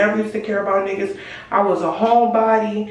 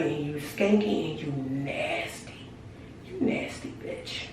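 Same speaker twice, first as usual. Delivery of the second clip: whispered insult; 5 semitones lower, 11 dB quieter, slower than usual. Retold or imitated. Insulting friend, hushed.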